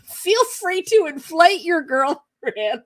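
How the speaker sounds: slow, high, laughing reread. Real, not acted. Rereading Steve's text to viewers, laughing slowly. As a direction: drag the line out while laughing.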